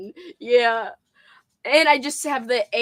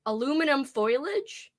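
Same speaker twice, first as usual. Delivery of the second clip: usual speaking voice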